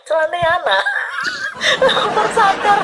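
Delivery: high, bursting laughter through the words; laughing at the pants seen on camera, high and breathless. Real, not acted. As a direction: crack up mid-sentence.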